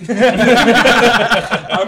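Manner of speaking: high, loud, slow laughing words. Real, not acted. Comedian laughing after his mock threat to Doug Ford. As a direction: crack up at your own threat.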